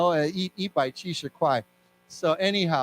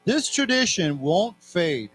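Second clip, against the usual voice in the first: slow, evenly spaced phrases with long pauses; read-along. Shown as a demonstration. Teacher leading students through a text, slow read-along. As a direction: read slowly, leaving room for repeats. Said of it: "So let's read this together".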